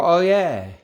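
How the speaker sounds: a hammed-up oh yeah; mock enthusiastic. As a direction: gush like an eager audience member. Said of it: "they're supposed to go".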